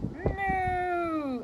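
high, loud, long moo; cow's moo. Acted. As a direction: moo out like a cow. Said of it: "Then the cow said".

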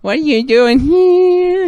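high slow squeaky nanny voice; Fran Drescher voice. Acted. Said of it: "How does Fran Drescher talk?".

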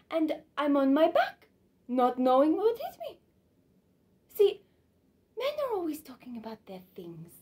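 lively monologue with high, swooping pitch; Suzanne musing animatedly. Acted. Suzanne puzzling over why men win her, animated and emphatic. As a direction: build emphatic bursts, then drop back.

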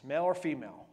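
usual speaking voice

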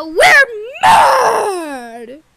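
loud, far-off yelled chant; mad shout. Acted. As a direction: bellow it.

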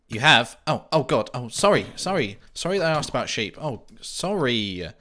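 loud, high panicked sorry; startled apology. Real, not acted. Startled by an angry villager, yelping sorry while backing away. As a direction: back off in a fright.

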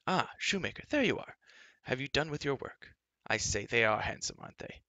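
lightly voiced high, chatty young prince; a touch of a lofty, cheerful prince; breezy prince. A narrator's reading. Prince Run greeting the shoemaker, lightly voiced breezy prince.

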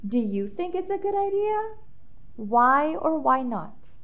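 ordinary delivery, nothing marked

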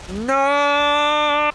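long, high wail of "no"; hammed-up drawn-out scream; dramatic "no". Defeat in game.

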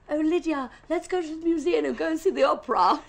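refined suitor. Acted. Daughter imagining her ideal next man, put-on refined voice. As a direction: play a cultured gentleman proposing an outing. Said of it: "I want him to be like".

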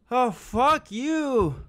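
scared drawn-out curse. Real, not acted. Scared in dark horror game.

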